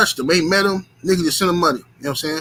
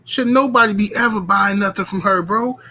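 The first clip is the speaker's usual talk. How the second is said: normal talking voice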